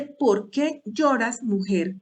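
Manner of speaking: drawn-out, lingering question; slow, weighty question. Shown as a demonstration. Preacher slowly repeating Jesus's question to the women. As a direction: let the question sink in slowly. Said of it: "se lo estaba diciendo para que ella pensara".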